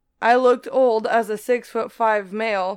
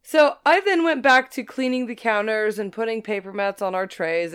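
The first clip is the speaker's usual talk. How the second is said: ordinary delivery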